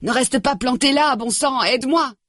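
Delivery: high-pitched angry demand; urgent angry plea. A narrator's reading. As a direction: snap for help, pitch way up. Nick in pain, demanding.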